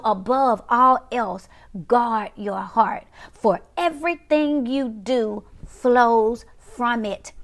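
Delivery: "guard your heart" slow and spaced out; emphatic scripture recital. Real, not acted. Preacher restates the verse, slow deliberate emphasis on "guard your heart". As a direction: draw out "above all else" and let "flows from it" fall slowly.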